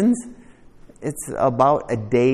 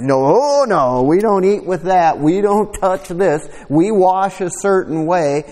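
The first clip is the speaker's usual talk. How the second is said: mock Pharisee; 6 semitones higher than usual. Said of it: "It's like, Pharisees".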